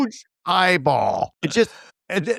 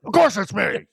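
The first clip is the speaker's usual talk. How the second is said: muffled, as if through a wall; 5 semitones higher than usual. Retold or imitated. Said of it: "he's muffled".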